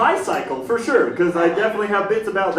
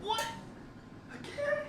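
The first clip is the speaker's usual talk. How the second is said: waking-up dismay. Acted. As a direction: start small and baffled, end gamely. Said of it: "I was like".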